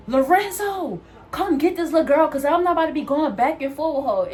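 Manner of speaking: high, loud mock yell of the coworker calling the supervisor. Coworker yelling to the supervisor about her, mock loud. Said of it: "she was like".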